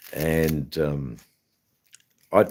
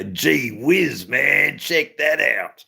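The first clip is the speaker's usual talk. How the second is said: put-on Australian accent; 9 semitones higher, 3 dB louder, much slower than usual. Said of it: "what would Australians say".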